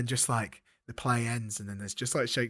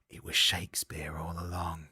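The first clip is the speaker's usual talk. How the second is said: breathless low whisper of realisation; hushed gasp of a stunned reveal; shocked whisper; 7 semitones lower, 4 dB quieter than usual. Pretend. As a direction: whisper it like the twist just hit. Dramatic realisation in the story.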